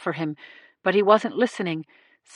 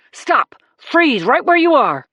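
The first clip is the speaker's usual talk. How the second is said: loud, higher police command; yelled warning; 6 semitones higher, 4 dB louder than usual. A narrator's reading. Cop warning a charging man to stop, loud yell. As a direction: bark the order to halt. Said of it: "Jacoby yelled".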